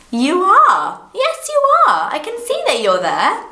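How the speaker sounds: squealing "yes, you are", very high; playful excitement. Real, not acted. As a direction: point at the viewer and insist gleefully. Teacher playfully addresses the viewer.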